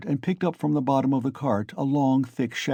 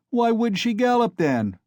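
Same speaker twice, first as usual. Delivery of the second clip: shouting; 12 semitones higher, 4 dB louder than usual. A narrator's reading. Crowd jeering.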